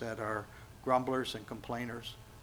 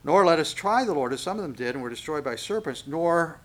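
scripture reading; 3 semitones higher, 7 dB louder than usual. Real, not acted. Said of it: "here's the next phrase".

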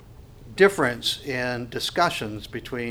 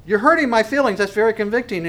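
higher, louder mock whine of a stung listener; hurt complaint; 6 semitones higher, 5 dB louder than usual. Preacher voicing an unbeliever who feels convicted, mock hurt. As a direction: play the offended unbeliever, a touch wounded.

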